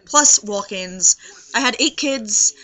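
usual speaking voice

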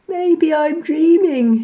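high, drowsy musing; tired little monkey; sleepy monkey. A narrator's reading. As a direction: yawn it out. Day Monkey yawning, sleepy.

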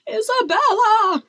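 high, loud outburst; enraged cry. A narrator's reading. King tearing off his wig in rage, crying out. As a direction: explode on the name. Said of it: "cried the king".

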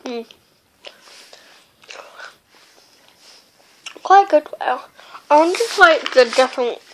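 chewing while talking; eating sweets between words; mouth full of candy. Real, not acted. Seen 0: fingers repeatedly put candy to lips; mouth closed, chewing likely.

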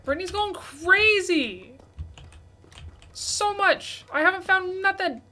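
high, loud excited yell; excited shout. Real, not acted. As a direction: shout it out in playful envy. Friend finds diamonds, none for him.

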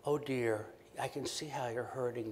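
soft, hushed, pitying tone; mock syrupy pity. Shown as a demonstration. Therapist wrongly pitying a depressed mother, soft and syrupy. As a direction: coo it down at her like she's fragile. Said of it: "Now, we don't say that".

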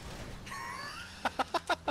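shrieking laugh. Real, not acted. Kill replay reaction.

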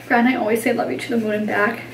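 usual speaking voice

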